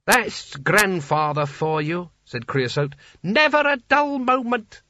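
slow, then a squeaky high delight; a proud grandson beaming; delighted sultan. Acted. Creosote admires grandad again.

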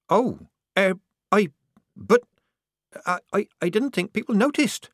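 high, halting, flustered; embarrassed stammer. A narrator's reading. Disguised girl found out by a stranger, embarrassed stammer. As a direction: stumble over the words, mortified. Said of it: "glowing with embarrassment".

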